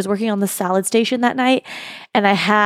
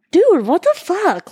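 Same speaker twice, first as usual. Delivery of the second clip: mock exasperated; 9 semitones higher, slower than usual. Retold annoyance at rude tables.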